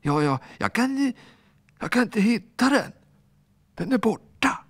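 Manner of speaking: put-on high, stammering little boy; frightened stammer. Little ball boy afraid of Alfons, high and stammering. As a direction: plead with a shaking little voice.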